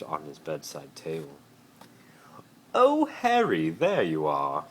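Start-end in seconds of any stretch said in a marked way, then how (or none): 2.7-4.6 much higher, loud, sing-song greeting; friendly Malfoy. Acted. Malfoy greeting Harry in his bedroom, falsely friendly. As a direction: greet your arch rival like an old friend. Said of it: "in a tone that suggested that he and Harry were friends".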